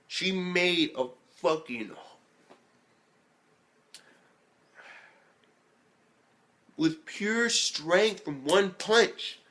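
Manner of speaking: high, very slow hype; awed emphasis. Real, not acted. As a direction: drag out each word in amazement. Awed climax of retold feat.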